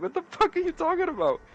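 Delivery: mock baffled outrage. Retelling his reaction to Paulo's nonsense, high mock disbelief. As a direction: pitch it way up like you can't believe it. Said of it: "I was like".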